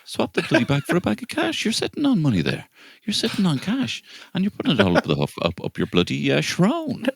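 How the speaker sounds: high, mock-preachy lecturing voice; ranting at the kids. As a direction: wag a finger at the kids in a squeaky voice. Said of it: "I'm like".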